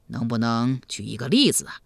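low, calm elder's voice; deep, measured ruler; questioning emperor. Acted.

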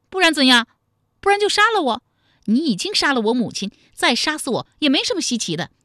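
high, loud, defiant retort; defiant captive. Acted. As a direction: throw it back at the captor. (高声顶撞，毫不服软)

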